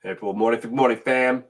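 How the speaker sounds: normal talking voice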